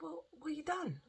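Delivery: high, soft worried question; retold concern. Retells her worried question to husband.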